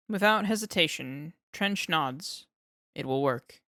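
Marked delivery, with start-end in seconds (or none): 2.9-3.6 lower, deep and flat verdict; grave vampire. Acted.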